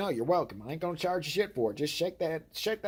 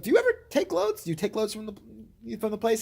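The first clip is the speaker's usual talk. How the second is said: mock innocent question; 8 semitones higher than usual. Teasing his brother with a high innocent question. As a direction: ask sweetly, baiting him. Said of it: "I was like".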